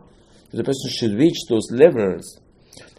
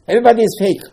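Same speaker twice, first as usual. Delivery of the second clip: higher and louder, hammer the point home; emphatic exclamation; 9 semitones higher, 6 dB louder, faster than usual. Real, not acted. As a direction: proclaim it to the room. Preacher exclaiming to congregation.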